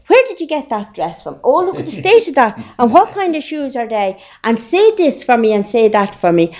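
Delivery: high-pitched mocking aunts; jeering. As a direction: mimic relatives picking on a child, pitched right up. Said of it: "I got an awful jeering".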